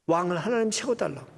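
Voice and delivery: ordinary delivery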